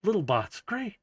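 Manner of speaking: high, softened exasperation; a little flustered. Acted. Kiara stuck minding the little bots, flustered. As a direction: sigh it out high, mildly overwhelmed. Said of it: "flustered a little bit".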